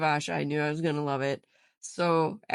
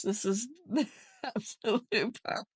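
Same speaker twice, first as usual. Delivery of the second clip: laughing; 7 semitones higher than usual. Real, not acted. Laughing through the words.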